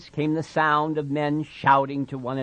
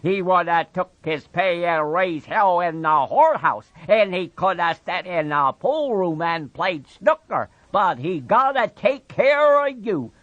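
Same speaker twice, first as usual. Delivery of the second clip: put-on higher, louder old woman's scolding; scolding Aunt Clara; 8 semitones higher, 6 dB louder than usual. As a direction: nag him like a fed-up aunt.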